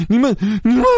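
high, sputtering anger; a roommate angrily scolding his friends; angry cry. A narrator's reading. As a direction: choke on the words in outrage. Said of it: "老七生气地喊道".

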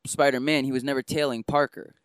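ordinary talk, nothing marked

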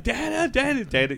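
high, urgent mock calling; excited calling. Calling partner over about wrestler's return, high excited mock call. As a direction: repeat the name high, like pestering.

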